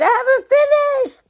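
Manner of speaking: wife protests to husband, high and tearful; anguished cry. A narrator's reading. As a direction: cry out against losing her model. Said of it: "Anne cried".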